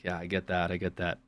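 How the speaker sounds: low, soft, subdued agreement; night-store small talk. Retold or imitated. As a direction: lightly act a low-key night shopper commiserating. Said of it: "I'm like".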